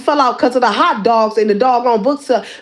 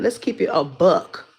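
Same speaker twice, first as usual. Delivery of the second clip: quiet aside; 9 semitones lower, 6 dB quieter than usual. Real, not acted. Muttered aside to rival.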